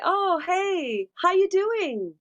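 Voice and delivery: high, bright American "how you doing" ending in a smile; American greeting. Shown as a demonstration. Dubbing demo of an American character greeting a friend, high and bright. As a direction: greet like a cheery American, lips closing on "doing". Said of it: "But in American, if I say".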